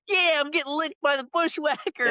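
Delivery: put-on very high-pitched squeal of a thrilled young fan; mock excited kids. Podcaster mimics kids at a wrestling show, shrill mock excitement. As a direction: imitate kids thrilled at something gross. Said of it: "the kids are all like".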